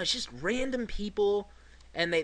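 ordinary talk, nothing marked

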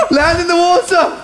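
excited yell. Real, not acted. Mid-fall shouting.

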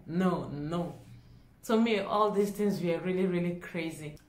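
normal talking voice

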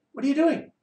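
high, disbelieving question; incredulous. Acted. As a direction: ask it in total disbelief. Incredulous challenge to audience.

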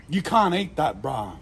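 normal talking voice

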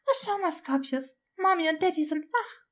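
put-on high, cheery baby-talk; cheerful. A narrator's reading. Grandmother showing baby his parents are awake, cheerful. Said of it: "fragte fröhlich".

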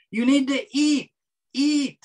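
a light, high nagging mother, "eat, eat". Retold or imitated. Mother pressing her son to eat, light nagging mock voice.

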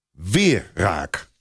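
ordinary delivery, nothing marked

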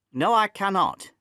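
father snapping a refusal at his son; sharp refusal. Acted. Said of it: "I answered sharply".

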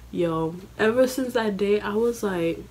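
normal talking voice

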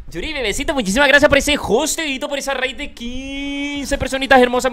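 mimoso, agudo y fuerte; da las gracias con la voz subida de emoción; agradecimiento efusivo. Real, not acted. Agradece la raid con mimo. (gracias muy efusivas)

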